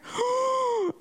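high sharp mock gasp. Reacting to Jean-Pierre blamed.

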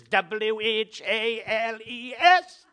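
"W H A L E S" spelled slowly, each letter apart. Shown as a demonstration. Preacher spells the pun for the congregation, letter by letter. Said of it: "Think of Jonah".

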